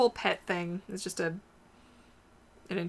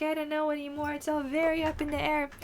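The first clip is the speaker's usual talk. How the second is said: higher, dreamy mock voice; lightly voiced dog's thought; 8 semitones higher than usual. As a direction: shrug it off as the dog. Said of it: "She's like".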